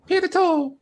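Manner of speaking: high, squeaky troll demand. Acted. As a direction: squeak it out like a tiny troll doll.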